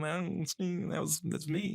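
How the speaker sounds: high whiny nerd voice. Pretend. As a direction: whine it like a nerd correcting you. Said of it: "I just gave him a little whiny voice".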